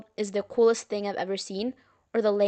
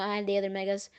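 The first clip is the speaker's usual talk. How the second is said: ordinary delivery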